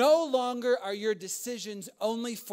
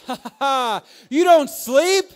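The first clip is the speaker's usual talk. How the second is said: loud laugh spilling into the words, higher; 5 semitones higher, 14 dB louder than usual. Real, not acted. Telling new parents they won't sleep, laughing loudly. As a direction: cackle through the punchline.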